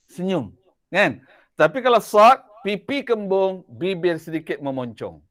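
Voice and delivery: normal talking voice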